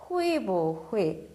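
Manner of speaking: 会不会 with 不 said light and unstressed; light neutral bu. Shown as a demonstration. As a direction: say 会不会 and let 不 fall weak. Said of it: "긍정 부정의 뿌는 약하게 읽어요". (会不会에서 不를 약하게 빠르게 읽기)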